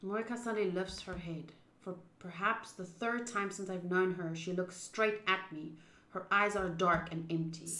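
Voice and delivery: low, quiet narration read; story narration. A narrator's reading. Reads the game's narration.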